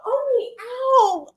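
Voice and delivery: high "ow" through laughter; yelp, half laughing; laughing yelp of pain. Real, not acted. Bitten by the dog, yelping and laughing.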